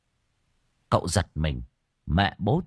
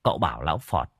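usual speaking voice

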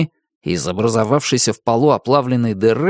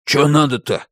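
gruff, unfriendly demand; unfriendly stranger. A narrator's reading. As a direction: snap at her like a grumpy stranger. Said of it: "неприветливо спросил".

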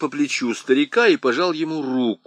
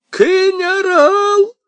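high loud drawn-out yell; screaming; 8 dB louder, much slower than usual. A narrator's reading. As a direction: call out desperately. Old man calling out.

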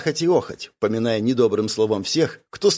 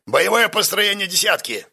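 barked order; 7 semitones higher than usual. A narrator's reading. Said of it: "приказал Карс".

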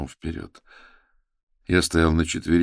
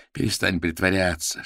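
ordinary delivery, nothing marked

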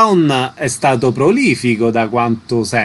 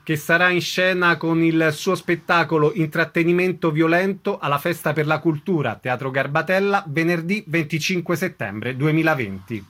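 measured, softer announcer voice introducing the guest; radio announcer read; 5 semitones higher, 4 dB quieter than usual. Acted. Radio host introducing the theatre guest to listeners, announcer read.

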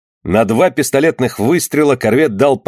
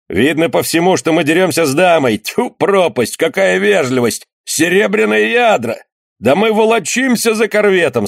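excited pirate; 7 semitones higher than usual. Acted. Pirate joking about silver shot.

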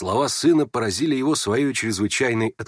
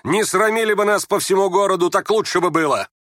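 higher-pitched exasperated rebuke; angry scolding; 9 semitones higher, 4 dB louder than usual. Acted. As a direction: scold him in a raised voice. Ганя scolds his father, after shouting. (сердитый упрёк на повышенном тоне)

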